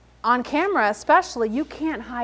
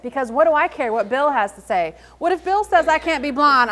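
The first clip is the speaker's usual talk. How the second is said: ordinary talk, nothing marked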